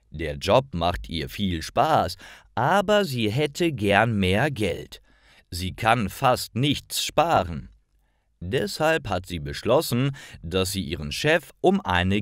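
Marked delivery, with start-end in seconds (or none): none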